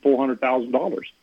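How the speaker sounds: normal talking voice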